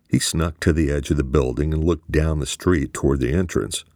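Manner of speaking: usual speaking voice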